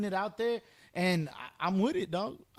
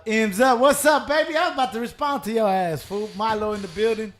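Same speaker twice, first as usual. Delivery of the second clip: loud hype greeting; 8 dB louder than usual. Real, not acted. Greeting a newcomer loudly.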